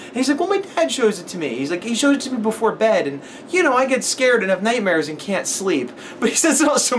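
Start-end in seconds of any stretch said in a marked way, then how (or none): 0.4-5.9 put-on little kid voice. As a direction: talk like a small boy explaining a movie. Kid explaining.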